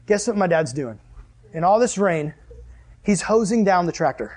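ordinary talk, nothing marked

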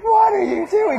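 high-pitched mock shout; nosy neighbor. Neighbor spotting mom stuck in the window, mock shout. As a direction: call out in disbelief as the neighbor.

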